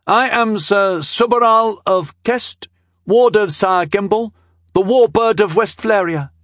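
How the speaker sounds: put-on high, loud boyish proclamation; boy herald proclaiming. A narrator's reading. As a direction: announce titles grandly in a youth's high voice. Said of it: "he proclaimed".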